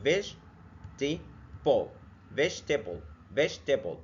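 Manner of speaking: vegetable stretched out in three parts, very slowly at first; slow syllable demo. Shown as a demonstration. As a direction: split vegetable into three beats. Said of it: "متقسمة تلات أجزاء".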